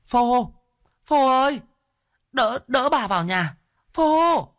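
very high, loud call for help; mother's high-pitched shout; urgent calling out. Acted. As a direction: call urgently to your son. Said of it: "Nghe tiếng mẹ gọi".

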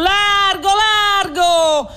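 high, loud call to make room; shouting to clear the way. A narrator's reading. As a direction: push through the crowd calling out. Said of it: "si sentì gridargli vicino". (grido alto per farsi largo)

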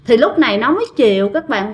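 plain reading voice

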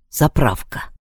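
ordinary delivery, nothing marked

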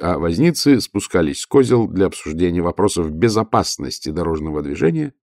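ordinary delivery, nothing marked

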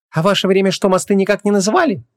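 high-pitched caustic question; venomous. Acted. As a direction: needle them with a pointed query. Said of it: "ядовито осведомилась Ванесса".

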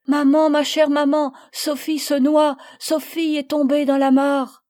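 high, loud, urgent telling; girl crying to her mother that her friend is drowning; panicked plea. Acted. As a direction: blurt out the news in panic.

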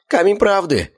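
high, uneasy gulped answer; jittery captive; nervous. A narrator's reading. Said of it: "нервно сглотнул Щуплый".

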